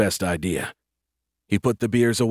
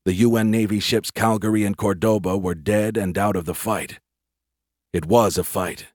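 usual speaking voice

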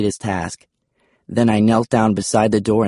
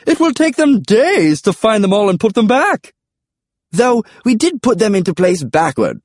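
high, loud comic dinosaur voice; excitable high-voiced creature; dinosaur Charles; 12 semitones higher, 4 dB louder than usual. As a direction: pitch it high and call it out.

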